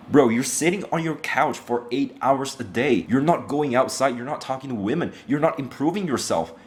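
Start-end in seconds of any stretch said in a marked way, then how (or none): none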